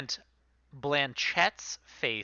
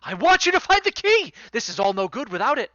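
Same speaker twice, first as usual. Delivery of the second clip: urgent reply; 9 semitones higher, 5 dB louder than usual. Acted. NPC answers the party.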